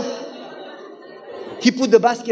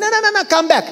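high, rapid-fire protest; calling back; 11 semitones higher, much faster than usual. Retold or imitated. Host calling back the neighbor who left, protesting. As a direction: call him back, voice shooting up.

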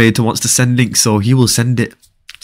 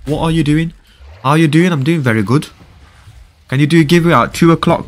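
ordinary talk

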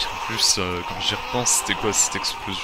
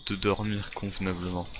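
much softer, near whisper; hushed; 11 dB quieter than usual. Real, not acted. Gamer hiding from zombies to viewers, hushed talk. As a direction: keep your voice down, they might hear.

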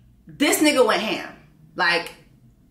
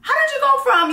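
mock curious question; 13 semitones higher, 3 dB louder than usual. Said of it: "I was like".

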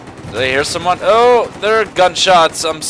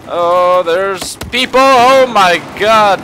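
high, loud startled outcry; startled alarm; 6 semitones higher, 5 dB louder, slower than usual. Real, not acted. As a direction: burst out in alarm, stretching "god". Startled by enemies appearing.

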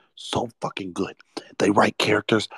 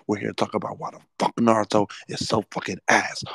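ordinary delivery

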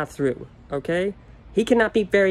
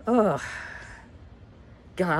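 ordinary talk

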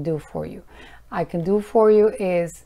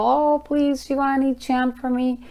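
mock pleading fan; 6 semitones higher than usual. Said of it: "I get people".

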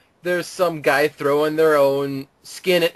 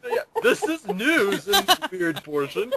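laughing; 3 semitones higher, 4 dB quieter than usual. Real, not acted. Still laughing.

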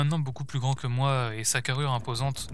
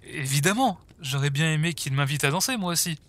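joking indignation; 3 semitones higher than usual. Acted. Ron jokes that he wanted the dance invitation too, mock-indignant. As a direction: protest playfully with a lifted voice.

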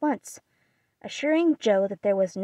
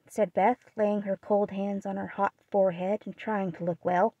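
ordinary delivery

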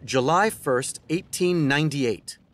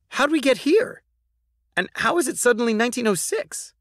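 put-on higher child voice, puzzled; Georgie asking; 9 semitones higher than usual. Georgie asking Roosevelt how they got there, child voice. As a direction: ask it like a bewildered kid. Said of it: "asked Georgie".

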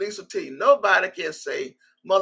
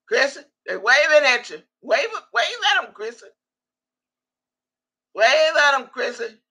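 calling out; 5 semitones higher than usual. Real, not acted. Calling a viewer to wave back.

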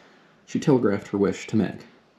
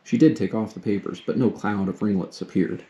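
usual speaking voice

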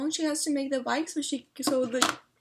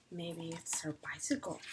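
whisper; 6 semitones lower, 13 dB quieter than usual. Real, not acted. Reader whispering a guess to the listening child. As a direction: breathe it like a secret.

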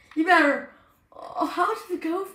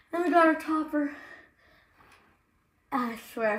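usual speaking voice

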